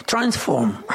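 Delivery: ordinary talk, nothing marked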